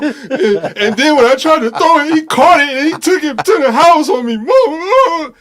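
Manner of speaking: high, loud hammed-up wail; mock sob shouted high to mama. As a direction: wail like a crying player telling his mom. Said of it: "That's what he looked like".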